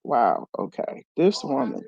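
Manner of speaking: quietly amazed at her friend's resolve; muttered disbelief. Retold or imitated. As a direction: shake your head at her, under your breath. Retold reaction to friend's calm.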